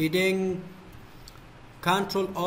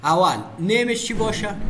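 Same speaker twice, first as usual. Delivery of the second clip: usual speaking voice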